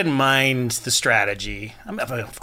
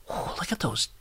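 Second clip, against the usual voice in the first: breathy unvoiced whisper, much softer; hushed whisper; 11 dB quieter than usual. Podcaster retelling how he eyed Dee's toes, whispered. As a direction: whisper it as if afraid to be overheard.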